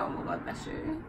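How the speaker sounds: breathy mock whisper of awkward sympathy. Friend cringing at a sneeze, whispered.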